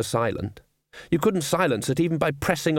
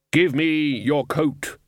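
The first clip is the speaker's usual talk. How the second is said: slow, higher, drawn-out demand; commanding Death; 4 semitones higher, much slower than usual. A narrator's reading. Death ordering the Dean to hand over his coat, in a slow, weighty voice. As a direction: spell the order out slowly as Death.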